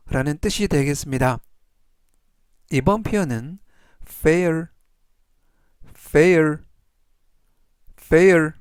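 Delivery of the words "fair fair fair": fair said clearly, louder with each take; teacher modelling fair for learners to repeat; model pronunciation. Shown as a demonstration. Teacher drilling fair for the class, slow and clear. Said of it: "이라고 발음하시면 되겠고요".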